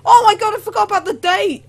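high, loud burst of alarm; panicked exclamation. Real, not acted. Gamer who forgot the date, panicked exclamation. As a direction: blurt it out as if suddenly remembering.

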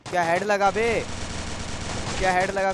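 excited boast. Real, not acted. Gamer celebrating a headshot to his teammate, excited. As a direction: crow over the shot.

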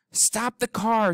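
high, slow shout to stop the car. Acted. Tootie shouting at the car to stop. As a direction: call out to stop them, drawn out high. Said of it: "she shouted".

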